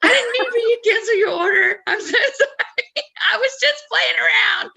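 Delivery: high, loud, rushed imitation of panicked pleading; mock panic. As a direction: squeal a hammed-up apology through laughter. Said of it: "now Tom is panicking 'cause he's like".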